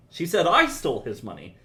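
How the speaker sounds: "I" punched high and loud; stress on I. Shown as a demonstration. Teacher shows stress to the composer, punching "I". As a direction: hit the word "I" hard. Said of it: "depending on how you emphasize".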